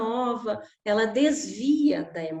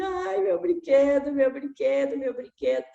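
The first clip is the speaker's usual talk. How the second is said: put-on crying child; 3 semitones higher than usual. Little son wailing over his broken toy, high whine.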